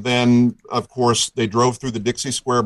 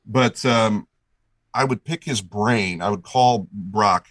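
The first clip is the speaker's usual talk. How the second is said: usual speaking voice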